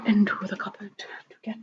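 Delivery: hushed, breathy aside; whisper. Real, not acted. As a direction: whisper it on the move. Sneaking to the cupboard.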